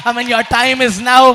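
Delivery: slow, loud, emphatic summons; fervent revival declaration; building preaching charge. Real, not acted. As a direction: commission them with mounting force.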